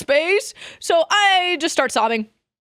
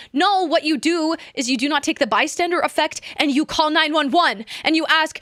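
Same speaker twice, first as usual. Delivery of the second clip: normal talking voice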